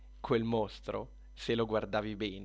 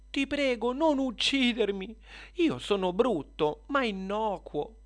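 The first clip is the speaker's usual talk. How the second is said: pleading spider; 10 semitones higher, 4 dB louder than usual. Acted. Spider begs for its life.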